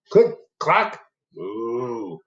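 usual speaking voice

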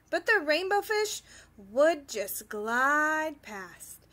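ordinary delivery, nothing marked

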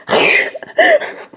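very high breathy giggle; high unvoiced giggle; giddy laugh. Real, not acted. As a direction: let out a squeaky laugh. Laughing at himself.

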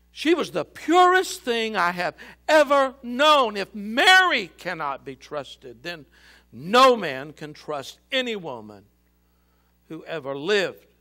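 high, loud despairing protest; anguished. A narrator's reading. Joseph despairing over Mary's betrayal, loud anguish. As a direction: rage at broken trust. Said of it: "the young man anguished".

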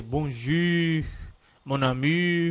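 bonjour said wrongly with French u, bonjy; mispronounced bonjour with u. Shown as a demonstration. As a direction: overdo the pout in bonjour. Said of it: "você quer pronunciar tudo com a letra U".

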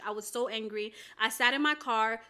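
ordinary talk

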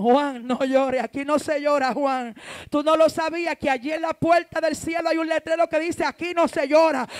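loud preacher's rebuke; forceful admonishment. Acted. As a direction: tell him off firmly at full volume. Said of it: "a Juan le dijeron". (reprensión fuerte, a toda voz)

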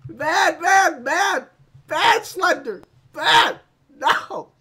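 high, loud, repeated scolding; hammed-up indignant telling-off; scolding shouts. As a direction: scold the monster like a naughty pet. Scolds the monster.

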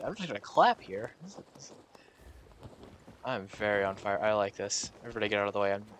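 ordinary talk

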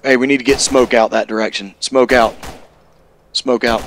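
loud urgent command over gunfire; shouting orders. Real, not acted. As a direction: call it out across the field. Calling for smoke in firefight.

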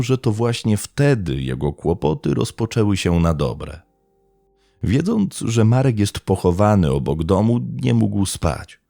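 usual speaking voice

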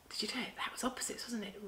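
soft whispered aside; hushed aside. Shown as a demonstration. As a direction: lean in and whisper to the kids.

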